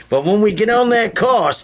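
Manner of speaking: ordinary delivery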